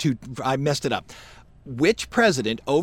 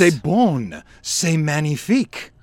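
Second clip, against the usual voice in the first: put-on French accent; 3 dB louder than usual. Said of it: "That's French, right?".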